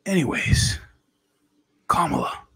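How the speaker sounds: soft, breathy confiding whisper; whispery, halting politician voice; President Biden. Acted. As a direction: answer in a hushed presidential impression. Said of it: "[name], President Biden".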